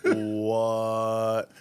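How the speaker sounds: long, drawn-out stunned "what"; stunned pause. Retold or imitated. Passenger reacting to the Turkish driver's reveal, stunned and drawn out. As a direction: stretch it out, frozen in disbelief. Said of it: "I, like, paused".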